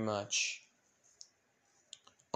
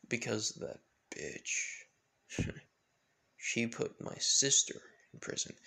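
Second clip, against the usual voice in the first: menacing villain; 6 dB quieter than usual. Acted. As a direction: let it seethe out quietly, with long pauses. Villain line redone.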